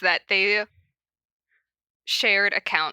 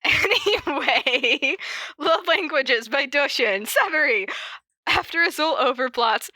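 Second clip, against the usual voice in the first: giggly fresh start; 5 semitones higher than usual. Real, not acted. Restarting the read, giggling.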